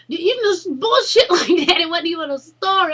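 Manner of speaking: loud, high, cracking up; high, laughing scoff; laughing disbelief. Real, not acted. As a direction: laugh through the complaint. Laughing at the silly books.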